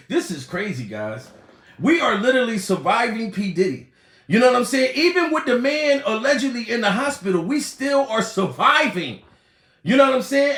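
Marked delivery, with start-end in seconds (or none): none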